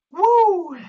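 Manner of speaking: loud high whoop; excited whoop. Real, not acted. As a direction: whoop to pump the crowd. Hyped cheer at video start.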